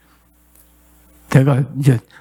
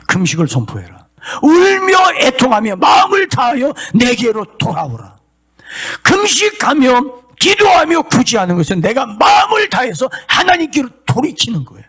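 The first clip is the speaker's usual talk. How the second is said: tearful preaching; 7 semitones higher than usual. Acted. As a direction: plead with the congregation through tears. Preaching the call to fasting.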